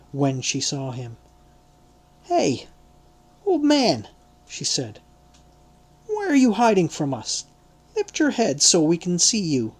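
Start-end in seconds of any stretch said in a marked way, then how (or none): 2.2-9.7 put-on high, sharp young woman's challenge; a pointed, higher-pitched servant girl's voice; accusing maidservant. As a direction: call him out like a nosy servant girl.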